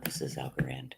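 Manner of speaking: hushed correction; whispering. Real, not acted. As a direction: whisper it aside.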